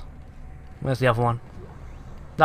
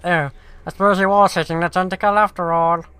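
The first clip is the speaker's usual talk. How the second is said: mock game voice; 3 semitones higher, 4 dB louder than usual. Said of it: "before you finally recognize".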